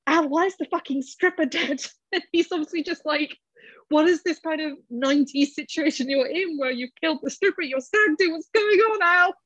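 high, exasperated imitation of a shocked friend; incredulous mock outrage. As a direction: play it as a hammed-up disbelieving buddy. Mocking Joe's outburst about stripper.

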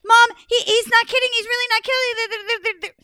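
high, loud frightened pleading; scared sister. Acted. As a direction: beg Mom to believe the ghost.

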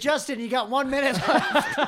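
calling out the time, loud and high. Real, not acted. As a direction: shout the warning across the room. Announcing time left, teasing.

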